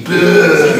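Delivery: bœuf said loud, the French way, breaking into a laugh. Shown as a demonstration. Teacher getting the class to repeat bœuf. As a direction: lead the class in saying bœuf. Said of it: "Say it with me".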